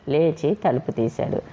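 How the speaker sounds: usual speaking voice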